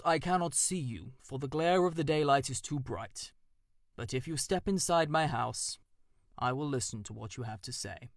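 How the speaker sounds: a wise owl questioning from his hollow; stern old owl. Acted. Owl invites mouse inside.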